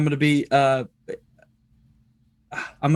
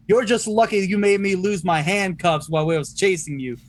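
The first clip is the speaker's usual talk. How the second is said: higher, deliberate declamatory voice; playing the detective; 5 semitones higher than usual. Detective to captive and worried folks, raised official announcement. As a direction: speak up officially for onlookers.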